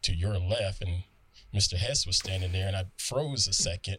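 ordinary talk

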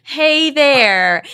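cheery greeting. Shown as a demonstration. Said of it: "I'm gonna say".

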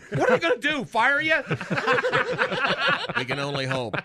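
ordinary delivery

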